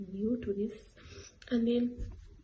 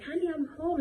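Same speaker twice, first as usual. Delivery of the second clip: high mock "Honey, I'm home" call; playful greeting; 8 semitones higher than usual. Joking to friends at school, high playful homecoming call. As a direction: sing it out like coming home to a spouse. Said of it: "telling people".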